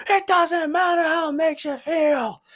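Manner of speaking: high shouting wrestler voice; mock Rock catchphrase yelled high; The Rock impression. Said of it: "Mick Foley's like".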